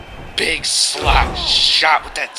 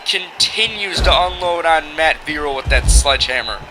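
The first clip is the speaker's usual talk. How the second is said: wrestling commentator; 4 semitones higher, 6 dB louder than usual. Acted. Calls the match play-by-play.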